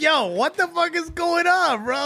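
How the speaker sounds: high-pitched mock disbelief; incredulous retold complaint, high and drawn out; exasperated. His exasperated reaction in the story.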